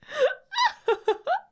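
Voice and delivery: giggling shriek; high squealing laugh. Real, not acted. Streamer laughing at orc idea.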